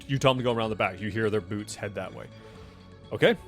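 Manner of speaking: ordinary talk, nothing marked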